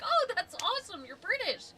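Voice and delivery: high-pitched excited fan imitation; happily impressed. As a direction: imitate someone thrilled to meet a foreigner. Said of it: "get really happy".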